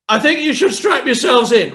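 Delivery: a captain losing his nerve on the intercom; panicky pilot. Retold or imitated. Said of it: "the panicky".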